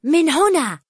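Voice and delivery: loud, high call to follow; shouting. A narrator's reading. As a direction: shout the way ahead. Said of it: "صائحا".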